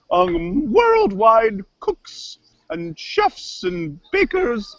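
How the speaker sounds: halting, grandiose family pride; kooky cook character, affronted. Acted. Cook defends her family to rude host, affronted kooky voice. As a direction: stretch the grand words with wounded dignity. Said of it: "Where'd you learn to talk like that?".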